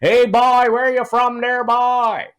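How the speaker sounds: high, loud Newfoundland drawl shouted across a bar; drunk Newfie yell. Retold or imitated. Drunk Newfie yelling at the comedian across the bar. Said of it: "he yells from across the room".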